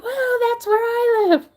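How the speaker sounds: mock starstruck gasp. Hearing her home city named on TV, squealing excitedly. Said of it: "It's like".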